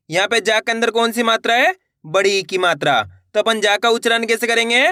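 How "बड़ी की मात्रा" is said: ordinary delivery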